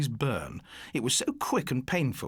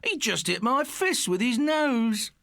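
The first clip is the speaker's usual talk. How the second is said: slow, loud, much higher jeer; mocking call; 10 semitones higher, 4 dB louder, slower than usual. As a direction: crow it to the laughing mates. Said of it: "Morris call to his guffawing mates".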